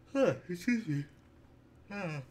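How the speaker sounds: talking through a big yawn; words stretched by a yawn. Real, not acted. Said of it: "I keep yawning".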